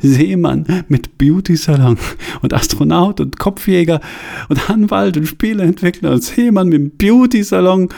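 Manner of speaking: high, rising, unhinged glee; manic laughing explanation. A narrator's reading. Johanna explains the joke to the headhunters, manic and high. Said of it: "den Witz zu erklären".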